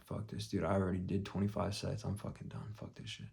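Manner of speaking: very soft inner grumble, fading to breath; fed-up muttering. Retold or imitated. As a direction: mutter to yourself that you're done. Retells fed-up thought before leaving.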